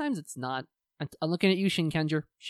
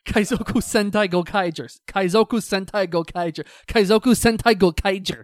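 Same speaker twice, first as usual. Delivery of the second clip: punchy repeats, building louder to the last; tongue-twister challenge; 3 semitones higher, 6 dB louder than usual. Shown as a demonstration. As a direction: rattle it off like a dare, finishing loud. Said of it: "three times fast".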